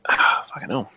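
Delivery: breathy whispered swearing under breath; whispered curse. Real, not acted. Muttering to himself at screen.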